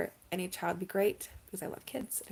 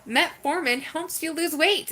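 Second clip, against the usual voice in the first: excited; 9 semitones higher, 7 dB louder, slower than usual. Real, not acted. Announcing good news.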